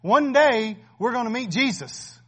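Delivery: a fervent sermon climax, loud and urging; preaching at full force. Acted. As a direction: drive the message home to the congregation. Pastor preaching with rising force.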